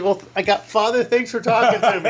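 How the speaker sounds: high, loud, laughing goodbye; laughing nervous exit. Retold or imitated. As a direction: laugh it off and try to leave. Hurrying to leave the office.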